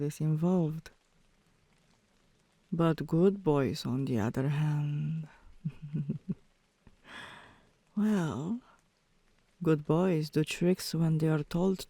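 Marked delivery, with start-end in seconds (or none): 5.6-6.3 short soft chuckle; a little laugh to herself. Real, not acted. Teasing pet, laughs alone.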